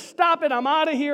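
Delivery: high, loud, hurried protest; fed-up mock outburst; acted quitting. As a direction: blurt it out like someone bailing. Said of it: "if he just says".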